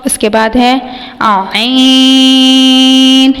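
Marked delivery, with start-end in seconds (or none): 1.2-3.3 عین (written آئین) held long from mid-throat; drawn-out throat letter. Shown as a demonstration. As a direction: squeeze آئین out of the middle of the throat. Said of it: "یہ وسط حلق سے لفظ ادا ہوگا". (آئین وسط حلق سے)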